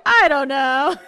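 loud, high exasperated cry; real flustered shout; flustered outburst. Real, not acted. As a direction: blurt it out, really loud and helpless. Flustered after failed accent attempt.

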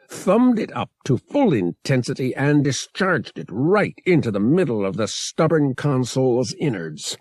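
ordinary delivery, nothing marked

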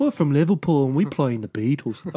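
put-on Liverpool accent. Host running with the failed impression, mock Liverpool accent. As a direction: impersonate a band member from Liverpool. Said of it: "I'm just sounding like a beetle".

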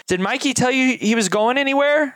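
Hope alarmed, calling down the hall; urgent demand. Acted. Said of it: "And then she goes".

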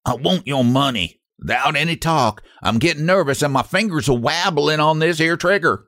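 lightly voiced menacing robber; a hint of a hillbilly gunman. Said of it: "said the figure".

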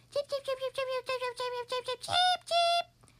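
high, rapid little chirps; gosling cheeping. A narrator's reading. Gosling on the pup's head, chirping high and fast. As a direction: chirp away like a baby bird.

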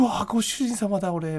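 high giddy delight; excited. Retold or imitated. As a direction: gush like a thrilled fan. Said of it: "みたいな".

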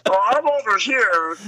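cartoon Vader giggling at Luke; Goofy Vader. Shown as a demonstration. Goofy-ish Vader calling to Luke, laughing.

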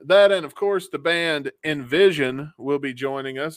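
normal talking voice

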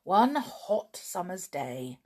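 ordinary delivery, nothing marked

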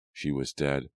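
ordinary delivery, nothing marked

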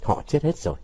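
soft, husky and subdued. A narrator's reading. Vũ holding his nose among the dead, husky voice. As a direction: let it come out quietly hoarse. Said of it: "giọng nó khàn khàn".

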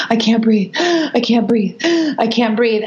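gasping between repeats, lightly panicked; mock gasps between short pleas; imitated breathless child. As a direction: voice a child struggling for air, mildly. Voicing a tantruming child gasping.

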